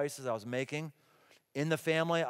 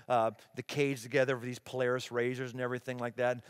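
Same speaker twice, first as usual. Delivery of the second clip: ordinary delivery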